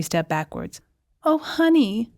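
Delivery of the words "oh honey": high, sugary sweetheart coo. A narrator's reading. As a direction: coo it sweetly, pitched well up. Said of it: "Cordetta cooed".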